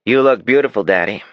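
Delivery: a brother parroting his sister to make fun of her; mocking imitation. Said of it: "Damon mimicked his sister".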